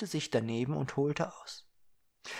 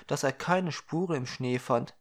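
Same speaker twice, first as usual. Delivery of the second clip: plain reading voice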